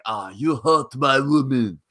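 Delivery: slow, hammed-up foreign accent; mock Rusev accent. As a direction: drag it out in a clumsy put-on accent. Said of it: "I don't know what that accent was".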